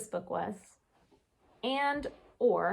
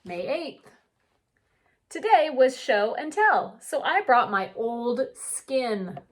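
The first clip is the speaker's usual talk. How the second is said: gentle storybook read-aloud; unhurried picture book narration; reading to children; 4 semitones higher, 6 dB louder than usual. A narrator's reading. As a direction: read it like story time for kids.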